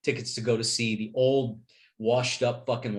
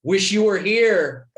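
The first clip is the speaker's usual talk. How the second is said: mock sweet message; 5 semitones higher, 8 dB louder, slower than usual. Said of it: "and go".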